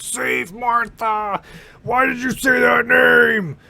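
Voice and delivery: high, slow, strained yell; angry shout. Retold or imitated. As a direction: bellow it, furious and desperate. Superman pleads, Batman shouts back.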